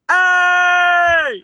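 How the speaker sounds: high, loud mock yell of hey; yelling imitation of a stranger; imitating a yell. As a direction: bellow it like a tweaker on the street. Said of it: "and he goes".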